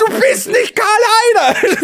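very high, loud outraged cry; exasperated yell. Retold or imitated. Son yelling at his mother in exasperation, very high. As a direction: screech it up high in disbelief.

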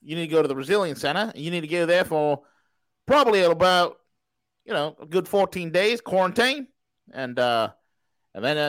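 put-on Australian show host; Australian accent. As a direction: carry on the broadcast as an Aussie. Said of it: "It's Australian V this morning".

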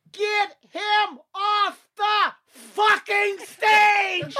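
high, slow drawn-out roar; furious roar. Retold or imitated. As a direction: bellow it from the wings. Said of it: "I hear the voice".

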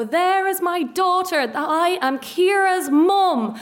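high, loud, drawn-out proud proclamation; imagined announcing mother. Pretend. Daughter dreading mum's announcement to the class, mock proclaiming. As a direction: announce it grandly to the whole room. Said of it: "is she gonna go".

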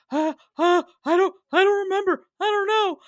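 mock airhead stammer; dumb bitch mode. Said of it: "dumb bitch mode".

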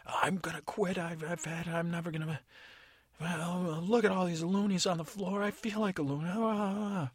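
hammed-up wail of a fairy at her limit; upset fairy. Fairy crying over spilled loonies, mock wailing.

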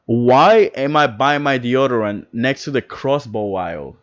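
usual speaking voice

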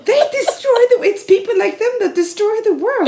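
high, loud, sing-song coo; woman replaying the beach man's sugary lecture. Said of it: "i snäll och vän ton".

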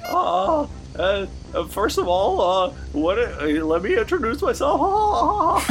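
high squeaky clown voice; whiny high-pitched clown; silly clown. Acted. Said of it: "That's what clowns sound like".

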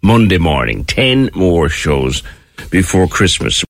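normal talking voice

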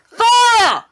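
loud, high alarm shouts; shouting. Acted. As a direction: bellow the warning down the hallway. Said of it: "just shout".

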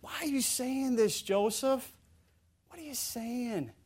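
small voice of a confused onlooker; hushed disbelief. Acted. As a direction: ask it quietly, as if puzzled. Voicing baffled reaction to Joseph.

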